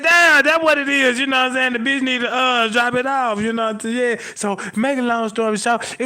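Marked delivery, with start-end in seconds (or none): 0.0-1.2 high, loud "damn" of sudden insight; mock shouted realization. Retelling his diagnosis, a loud high realization. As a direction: shout it like it finally clicked.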